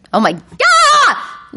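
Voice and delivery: sudden high startled cry, stretched out slow; panicked yelp. Acted. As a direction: shriek as the ride explodes. Borg attack, lizard brain panics.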